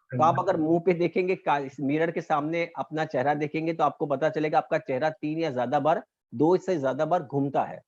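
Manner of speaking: ordinary talk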